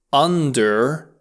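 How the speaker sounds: ordinary talk, nothing marked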